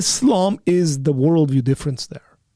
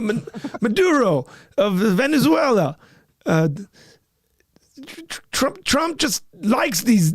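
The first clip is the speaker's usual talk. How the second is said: put-on higher, halting, stumbling old politician voice; mock Biden, pitched up and slowed, tripping over words; Joe Biden impression; 7 semitones higher than usual. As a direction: fumble the names like a rambling elderly president. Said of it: "Can you really like him?".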